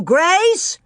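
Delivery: high, loud shout of a name; father's worried shout. A narrator's reading. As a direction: shout for the lost child. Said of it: "Pa shouted too".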